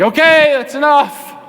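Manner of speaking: high, loud exasperated outburst; fed up. Acted. Listener worn down by endless yelling, acted fed-up outburst. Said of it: "They were fed up".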